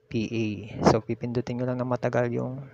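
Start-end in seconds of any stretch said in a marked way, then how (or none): none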